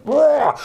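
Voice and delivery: high playful roar; a little monster's pounce-roar; wolf roar. Acted. Wolf leaping out of bed at the girl: high playful roar. Wolf roars while attacking.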